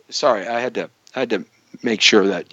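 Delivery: ordinary talk, nothing marked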